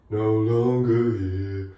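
slow, loud imitation of a low sung line; low register. Reactor imitates the singer's deep low line. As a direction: drop down low like the bass vocal. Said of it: "it's all the way down there".

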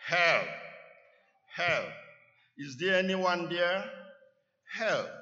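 loud desperate cries for help; yelling for help. A narrator's reading. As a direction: shout for help from the cliff. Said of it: "He kept yelling".

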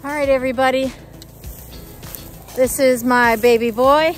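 ordinary talk

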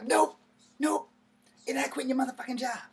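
high, loud and fast, cutting off; insistent mom. Retold or imitated. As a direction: rattle off the refusal.

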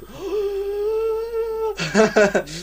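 long, high, hammed-up gasp of shock; dramatic gasp. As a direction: gasp as if you've seen a ghost. Dramatic gasp at character description.

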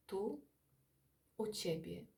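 ordinary talk, nothing marked

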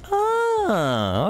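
sudden high-pitched "Oh" of surprise; surprised squeal. Acted. Sudden realisation about the water.

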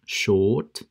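short said "shawt", with a long O; non-rhotic short, vowel held long; long O, silent R. Shown as a demonstration. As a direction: draw out the O in short and drop the R. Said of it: "con una O bien larga".

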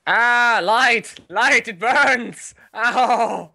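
high, loud hammed-up wail; burning monster. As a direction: screech like a creature in sunlight. Mock burning in sunlight.

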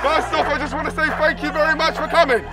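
high, projected crowd address; calling out. Real, not acted. Host addresses waiting line outside restaurant, calling out. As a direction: carry it over a whole queue.

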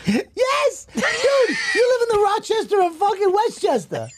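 very high-pitched, incredulous yelling; high exasperated shout. Real, not acted. Heated argument over travel time.

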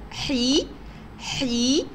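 حي held as a long breathy-h "hee"; teacher stretching حي as "heee"; sounded-out syllable. Shown as a demonstration. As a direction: isolate حي so the first sound is heard. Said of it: "يا ترى سمعنا صوت الحاء".

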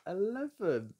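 high, loud landing on the last count; triumphant. Real, not acted. Counting partner's move onto Mayfair, triumphant. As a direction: land the final count in triumph.

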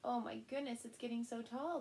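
plain reading voice

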